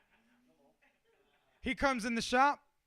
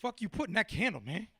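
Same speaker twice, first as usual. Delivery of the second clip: imitated grumbling client; faster than usual. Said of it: "is him being like".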